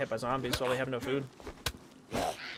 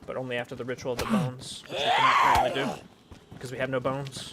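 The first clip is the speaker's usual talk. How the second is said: preachy shouting; 7 dB louder than usual. Acted. Ritual rant in character.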